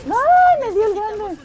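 very high, loud shriek; real pained yelp. Real, not acted. Player hit by the big monster, shrieking. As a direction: shriek it as the blow lands.

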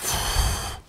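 long, voiceless blown-out breath; heavy sigh of emotion; overwhelmed exhale. Retold or imitated. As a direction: puff out air as if overcome. Exhales recalling the emotional moment.

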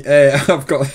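ordinary talk, nothing marked

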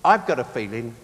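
ordinary talk

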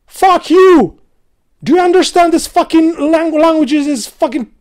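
loud, high yelling; furious shout. Real, not acted. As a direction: shout it at the language. Ranting about English spelling.

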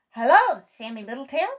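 loud, high opening hail, easing off; calling out. A narrator's reading. A voice calls to Sammy Littletail, calling out. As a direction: call out hello to someone a little way off. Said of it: "called a voice".

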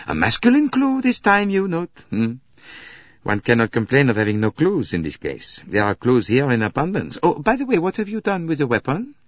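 high, lively detective voice; Poirot, animated. Acted. Poirot character in narrated story.

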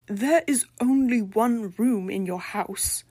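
slow, pressed accusing hiss; accusatory hiss. A narrator's reading. Eumenia appalled at the tiny apartment, accusing hiss. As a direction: hiss it at him like a charge. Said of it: "she hissed, accusatory".

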